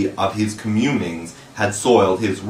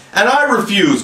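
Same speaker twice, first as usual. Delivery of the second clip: little brother joining the defiance; defiant cry; 11 semitones higher than usual. A narrator's reading. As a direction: shout it to back up his brother. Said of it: "cried Michael".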